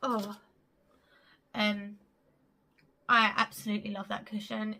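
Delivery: normal talking voice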